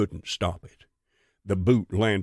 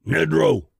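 loud, raised roar of command; 7 semitones higher, 5 dB louder than usual. A narrator's reading. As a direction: roar the order out. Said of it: "Bristol roared".

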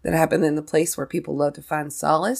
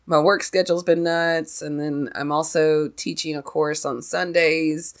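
ordinary talk

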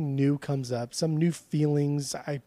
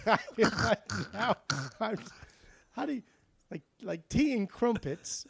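a higher, put-on English accent that keeps slipping Australian; hammed-up tea-and-crumpets English accent gone Australian; British accent sliding into Australian; 6 semitones higher, 4 dB quieter than usual. Shown as a demonstration. Podcast host tries an English accent for a joke, botched British accent. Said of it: "coming out Australian again".

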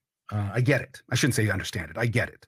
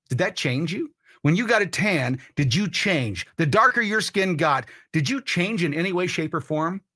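usual speaking voice

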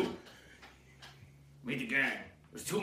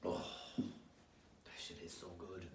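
hushed and breathy; 5 semitones lower, 11 dB quieter, slower than usual. Real, not acted. Savouring the drink.

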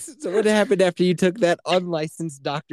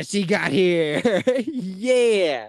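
slow high teasing; drawn-out announcement; 5 semitones higher, much slower than usual. Pretend. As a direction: stretch it out, delighted.

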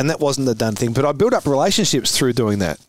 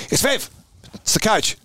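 calling out; 9 semitones higher than usual. Retold or imitated. Bench man calling, retold.

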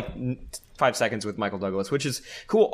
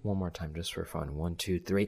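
softer, lower cue before the shout; quiet count-in; 6 semitones lower, 9 dB quieter than usual. Acted. Host quietly cues the cohost before the final plug. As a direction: murmur the count-in.

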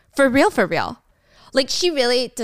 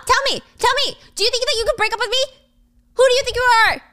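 much higher, fast furious voice; shrieking rage; 13 semitones higher, much faster than usual. Retold or imitated. Michael pinning Gia to the wall, shrieking. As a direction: scream it in his face.

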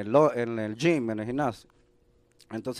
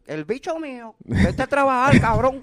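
much higher, louder mock rant at the lazy kid; gruff stepfather; 10 semitones higher, 5 dB louder than usual. Stepfather telling the kid to work for money, loud mock rant. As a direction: bark it like a tough Vietnam vet stepdad. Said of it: "y te decía".

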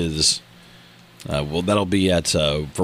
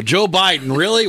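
high, incredulous yelled quote; mock yell; 9 semitones higher, 4 dB louder than usual. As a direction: imitate the guy yelling, pitch up. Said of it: "why is he yelling".

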